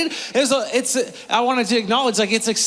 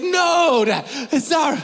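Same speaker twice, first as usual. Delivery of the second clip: put-on high, louder kid's no; protesting kid; 7 semitones higher, 3 dB louder, slower than usual. Son rejecting dad's magic claim, mock kid protest. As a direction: shut Dad's trick down like a kid.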